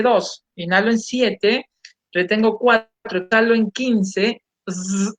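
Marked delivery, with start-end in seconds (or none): 4.7-5.1 sustained buzzing "zzz"; zumbido vibrante. Shown as a demonstration. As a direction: let the mouth vibrate on a long zzz. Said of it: "haciendo vibrar mi, mi boca". (zumbido sostenido haciendo vibrar la boca)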